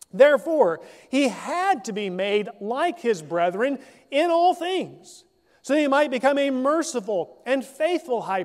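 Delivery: formal scripture read. Acted. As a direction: read the verse out to the congregation. Reads scripture passage aloud.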